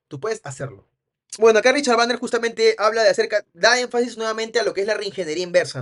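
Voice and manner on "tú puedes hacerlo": "tú puedes hacerlo" lower and softer, falling to a cut end; command intonation. Shown as a demonstration. Trainer repeats the order, "tú puedes hacerlo" low and falling. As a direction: drop "tú puedes hacerlo" downward like a firm order. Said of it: "lo hacemos hacia abajo".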